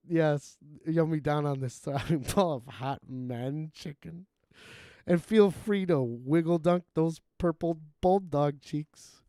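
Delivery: slow, odd voice for lyrics; put-on lyric voice. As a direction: recite the lyrics in character. Quoting the song lyrics.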